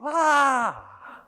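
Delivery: sudden high cry of pain; pained yelp. Retold or imitated. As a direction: yelp as if a sore spot was touched. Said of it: "I would go".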